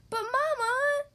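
high, pleading cry of protest; cubs protesting. Acted. Cubs protesting to Mama, high pleading whine. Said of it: "they protested".

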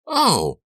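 high, loud startled "Oh!"; startled shriek. A narrator's reading. Girl bumps into someone hiding in the barn, startled shriek. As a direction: shriek out in sudden fright. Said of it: "let out a startled shriek".

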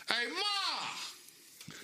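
put-on high Boston-accent call of Hey Ma. Boston guy in a commercial calling his mom, Boston accent. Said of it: "someone from Boston doing that shit".

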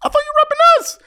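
very high-pitched mock outrage; indignant Laker fan. Voices indignant Laker fans.

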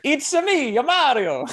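high, loud cartoon Italian impression; Mario. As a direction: shout it out in a squeaky-high mock Italian accent. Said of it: "do a very good Italian accent".

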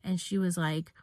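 ordinary delivery

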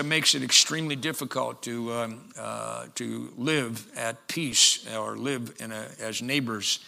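ordinary talk, nothing marked

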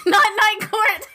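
high, loud correction through laughter; fast, high, laughing outburst; laughing protest. Real, not acted. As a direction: object loudly while cracking up. Protests spoiled reveal while laughing.

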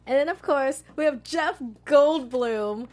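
building up to the name Jeff Goldblum; excited announcement. Real, not acted. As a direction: announce him like a star entering. Hyping the next news item.

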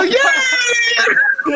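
very high shouted yeah; Silly Boy cheer. Retold or imitated. Comic in character cheering the crowd, very high. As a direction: whoop in character. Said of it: "And I go".

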